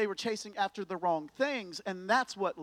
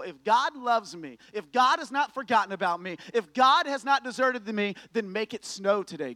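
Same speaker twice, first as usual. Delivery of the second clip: defiant challenge; 4 semitones higher, 7 dB louder than usual. Retold or imitated. Girl challenging God to send snow, loud and defiant. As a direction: dare God to prove it, rising in force.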